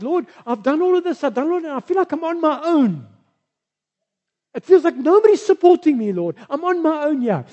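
high, loud self-pitying complaint; whiny Elijah. Acted. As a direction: whine like a sorry-for-himself prophet. Elijah's pity party complaint voiced.

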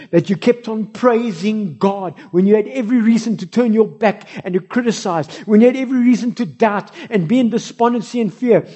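loud, high praise building; God praising the faithful, loud and fervent; rousing commendation. Acted. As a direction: proclaim it like a triumphant verdict. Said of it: "God is saying".

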